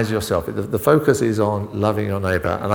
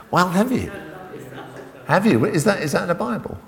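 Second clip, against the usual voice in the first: surprised challenge; 11 semitones higher than usual. Real, not acted. Listener misquotes the commandment.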